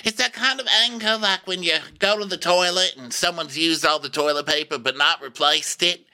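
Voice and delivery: high put-on diva voice; hammed-up high voice, lecturing self-importantly; Erica. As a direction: hold a high, exaggerated housewife impression. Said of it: "So Erica's like".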